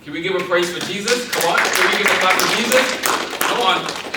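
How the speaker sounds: loud rising call to the crowd; rally the room to clap; calling out praise. Real, not acted. Preacher calling the church to praise Jesus.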